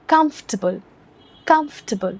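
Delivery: comfortable with KUMF stressed, rest reduced; stress on comf. Shown as a demonstration. Teacher showing students where the stress falls. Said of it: "stress होगा कम्फ में".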